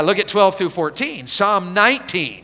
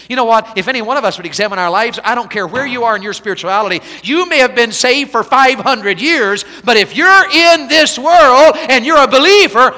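louder and higher, a climbing sermon cry; preaching at full force; 8 semitones higher, 12 dB louder than usual. Acted. A revival preacher pressing the congregation on errors, full force.

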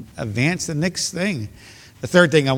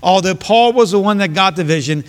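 preaching; 4 semitones higher, 4 dB louder than usual. Acted. Preaching builds louder.

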